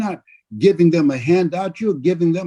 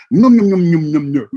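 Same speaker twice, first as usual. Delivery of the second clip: loud playful num-num chewing noises; mock chomping; 4 dB louder than usual. Speaker mimicking eating the wounded, playful loud chomping.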